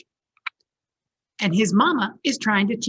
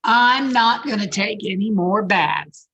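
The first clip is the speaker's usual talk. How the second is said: louder, defiant little bear; stubborn bear; 2 semitones higher, 6 dB louder than usual. Acted. Bear refusing to bathe, loud and defiant. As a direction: dig in and refuse the bath.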